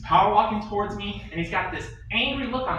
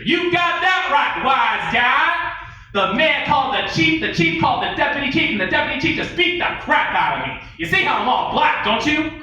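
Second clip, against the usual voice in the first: furious boss; 10 semitones higher, 6 dB louder than usual. Acted. The captain yelling at his smart-mouth cop, a high roaring rant. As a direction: scream it at the wise guy.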